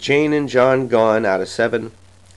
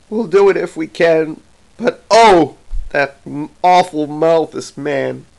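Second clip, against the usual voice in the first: understated sob, raised voice slowing at the end; sobbing wife; 3 semitones higher, 3 dB louder than usual. A narrator's reading. Mother answers the chastened father, mildly sobbing. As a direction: lament it with a touch of a sob. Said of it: "She sobbed".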